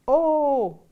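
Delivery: loud, high shout; shouted call. Acted. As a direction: shout back on cue. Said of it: "Ho'onani shouted back on cue".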